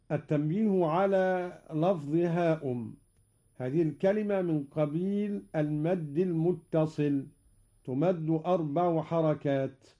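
normal talking voice

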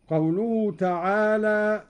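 ordinary talk

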